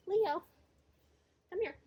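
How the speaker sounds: calling a cat loudly from a distance. Real, not acted. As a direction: call the cat, high and loud. Calling escaped cat outside.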